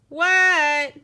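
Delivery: loud, high, drawn-out reply; hollering back. A narrator's reading. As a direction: kid yelling back to a parent. Froggy hollering back to mother.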